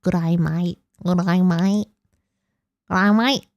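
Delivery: Good eye, mate, drilled over and over; mock Australian, repeated. Shown as a demonstration. Practising the Aussie greeting for friends, mock Australian. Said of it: "I feel like I'm eating a frog when I say that".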